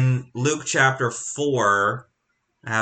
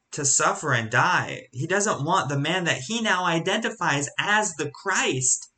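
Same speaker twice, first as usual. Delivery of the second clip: ordinary talk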